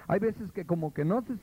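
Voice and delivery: ordinary talk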